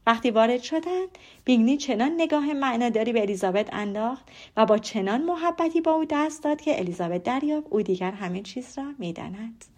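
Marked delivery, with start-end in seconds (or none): none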